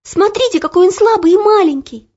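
put-on pleading girl. A narrator's reading. Olya pleading for the boy.

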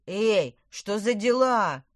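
loud, slow, drawn-out shout; indignant shout. A narrator's reading. Boy under fire yells at attackers, indignant shout. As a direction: call out in indignant protest. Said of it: "крикнул он".

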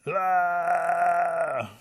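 long high loud "aah"; hollered wail. A narrator's reading. Rabbit keeps hollering from sticker bushes, mock wail. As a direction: sustain a put-on howl. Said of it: "He's still hollering".

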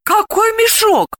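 slow, high-pitched astonished question; surprised. A narrator's reading. Delila hears of a sack, surprised. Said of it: "удивилась Далила".

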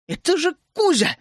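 high-pitched urgent yell. A narrator's reading. Narrator recognising his friends on the dragon, yelling. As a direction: call out high, as if at full strength. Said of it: "что было силы, заорал я".

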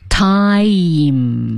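"Time" held long through the vowel; slow repetition. Shown as a demonstration. Host repeating after the teacher, drawn out. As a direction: draw out "Time" carefully like a learner copying. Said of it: "要慢慢地练".

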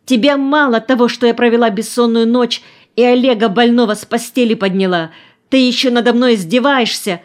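high, loud outraged cry; aunt shouting at the boy who mocks her; indignant shout. A narrator's reading. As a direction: shout at him, hurt and exhausted. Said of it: "закричала опять Нина".

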